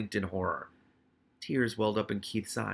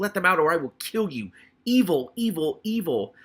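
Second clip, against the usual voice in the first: higher, louder rat threat; furious scream; 6 semitones higher, 5 dB louder than usual. Acted. Hammond Pork threatening to kill the humans. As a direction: spit out the threat and the curses. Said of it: "screamed Hammond Pork".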